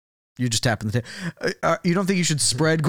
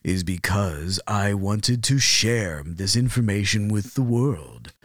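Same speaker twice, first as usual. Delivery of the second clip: lower, measured presenter voice; silky-smooth broadcaster, pitched down; 6 semitones lower, slower than usual. Acted. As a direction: play a self-assured TV presenter with a silky voice. Presenter explains why he came.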